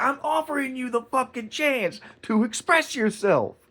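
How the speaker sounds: high, forceful challenge; re-enacted yelling. Man daring customer who threatened him, yelling. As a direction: dare him, pitch way up. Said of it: "I was yelling at him like that".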